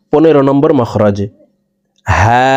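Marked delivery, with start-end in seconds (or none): 2.1-2.5 "হা" from the start of the throat, vowel briefly held; one-alif stretch. Shown as a demonstration. Teacher demonstrating ha, short even hold. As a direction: stretch "হা" for one measured beat. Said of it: "এক আলিফ টান হবে".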